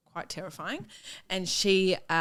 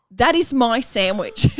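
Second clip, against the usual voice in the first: indignant claim; 3 semitones higher, 7 dB louder, slower than usual. Retold or imitated. Teacher confronting sandwich eater.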